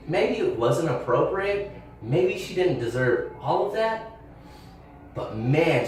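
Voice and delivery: ordinary talk, nothing marked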